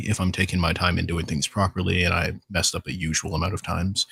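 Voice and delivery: ordinary talk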